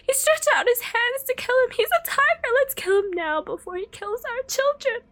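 very high, loud scream; frantic mother's high-pitched yell; woman crying out. Acted. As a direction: accuse in hysterical alarm. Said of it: "the woman cried".

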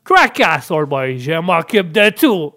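put-on slow, swaggering American accent. Coach telling student not to worry, slow American accent. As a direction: drawl it like a cocky American old boy. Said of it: "des cours avec l'anglais et l'américain".

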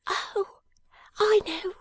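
high, bright eager exclamation; little dressmaker's high cry. Acted. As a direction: burst out in sudden understanding. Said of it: "cried Jenny".

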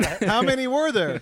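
hammed-up startled bank teller; mock alarm. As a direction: blurt it like a panicked teller. Bank teller confused question.

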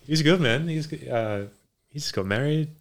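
ordinary delivery

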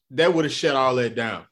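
ordinary delivery

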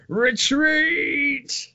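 high far-off mock yell of retreat; game soldier yelling retreat. Joking with a friend about a silent YouTuber, high mock yell. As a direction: squeal it like fleeing troops. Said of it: "they're probably going".

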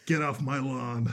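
put-on slow grumpy old man voice; old man yelling at kids. Mocking Rick as grumpy old man.

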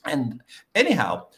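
ordinary delivery

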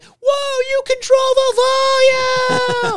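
squealing high-pitched shout; high squeaky mock voice. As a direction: shriek it in a tiny high voice. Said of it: "they were like".

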